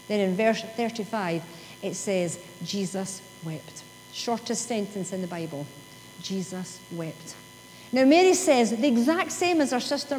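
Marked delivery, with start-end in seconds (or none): 2.6-3.8 very soft and slow, almost breathed; hushed reverent reading. Acted. Preacher reading the verse to the congregation, hushed and slow. 6.2-7.3 very soft, slow and low; hushed reverent repeat. Acted. Preacher repeating the verse to the congregation, hushed and slow.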